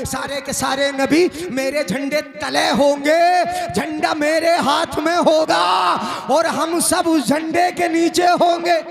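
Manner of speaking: sermon voice climbing into a high declamation; rising, ringing pulpit delivery; full-force preaching. Real, not acted. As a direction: proclaim it to the congregation, building as it goes.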